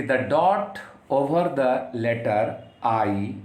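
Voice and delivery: very slow, word by word; slow dictation. Shown as a demonstration. Teacher dictating to students, slow and deliberate. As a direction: read it out slowly so students can write.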